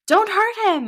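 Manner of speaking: high, loud pleading shout. Acted. Mother begging them to stop, pleading shout. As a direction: plead at full volume. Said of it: "my mother shouted".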